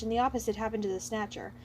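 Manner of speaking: usual speaking voice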